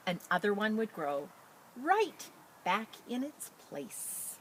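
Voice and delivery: expressive picture-book read-aloud; reading to children. Acted. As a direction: read it out brightly to a class. Teacher reads picture book aloud.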